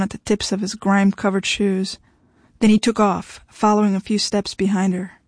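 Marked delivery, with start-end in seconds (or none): none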